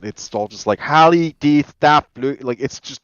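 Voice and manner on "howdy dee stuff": put-on slow, louder, high old text mangled in a modern Western accent; mock modern Western accent. Shown as a demonstration. Reading old legal text wrongly, in a mock modern Western accent. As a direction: drag it out in a hammed-up modern Western accent, like gibberish. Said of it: "with a modern Western accent".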